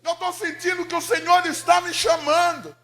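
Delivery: loud, high pleading shout; impassioned prayer cry. Retold or imitated. Mother telling Jesus she feels called, crying out. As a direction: cry out to God at full force. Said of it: "aumentava um pouco o volume da voz".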